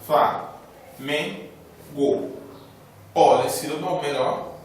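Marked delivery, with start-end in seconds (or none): 0.0-2.3 Flá, Men, Go, cada sílaba dita à parte com pausa; separando sílabas. Shown as a demonstration. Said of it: "Três sílabas". (sílabas de Flamengo ditas separadas)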